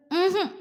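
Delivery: high little "uh-uh" hum; refusing hum. A narrator's reading. Girl refusing to look at him, humming no. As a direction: hum a small, wordless no. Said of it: "അവൾ ഇല്ലാന്ന് മൂളി".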